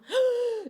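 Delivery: aspiración aguda de espanto, actuada; jadeo de susto. Retold or imitated. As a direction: toma aire de golpe, fingiendo susto. Ven de pronto una sombra. (jadeo agudo de sobresalto)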